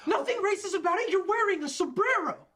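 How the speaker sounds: high, loud incredulous yell; outraged yell. Acted. Boss confronts employee over the sombrero, outraged high yell. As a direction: yell it back in sheer disbelief.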